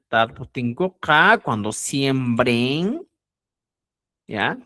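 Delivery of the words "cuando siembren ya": ordinary talk, nothing marked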